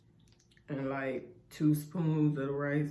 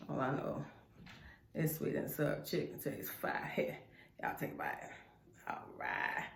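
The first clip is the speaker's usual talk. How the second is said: soft unvoiced whisper; 3 semitones lower, 12 dB quieter than usual. Real, not acted. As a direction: whisper it close to the mic. Eating, talking into the mic.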